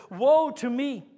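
loud anguished cry of woe. Retold or imitated. Isaiah before God, crying out woe. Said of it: "I cried".

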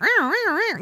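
high mock siren meows; alarm sound. Mom imitating her worried mom radar going off, high meowing alarm. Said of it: "my mom radar is just like".

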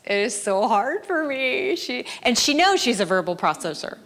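ordinary delivery

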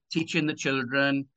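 usual speaking voice

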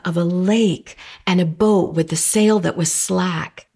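usual speaking voice

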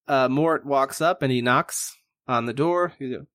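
normal talking voice

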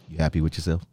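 low, quiet, calm disappointment; calm disappointed uncle. Retold or imitated. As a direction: speak softly like a let-down uncle. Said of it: "'Cause he was just so calm".

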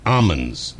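Almonds with the L dropped, AH-munz; silent L. Shown as a demonstration. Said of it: "pronunciation almonds with the silent L".

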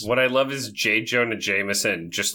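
usual speaking voice

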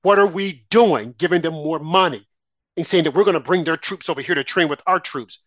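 normal talking voice

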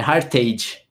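Hartage said the American way, "HAR-tage"; American pronunciation. Shown as a demonstration. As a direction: give the surname an American English reading. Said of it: "Se ele é americano mesmo".

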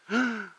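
shocked gasp. Acted. Hearing the word husband.

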